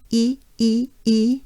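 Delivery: overdone smiling ee on E; exaggerated vowel. Shown as a demonstration. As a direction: exaggerate each E with a wide smile. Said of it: "We exaggerate those vowels".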